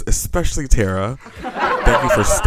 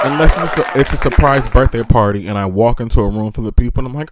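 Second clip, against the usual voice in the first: ordinary talk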